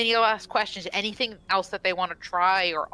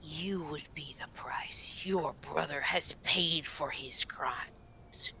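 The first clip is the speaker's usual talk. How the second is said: soft, whispered pronouncement; menacing forest spirit; 3 semitones lower, 10 dB quieter, slower than usual. Acted. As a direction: whisper a grave sentence, slowly at the end.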